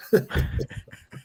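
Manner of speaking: breathy chuckle at his own joke; soft laugh. Real, not acted. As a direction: laugh quietly at the nerdy joke.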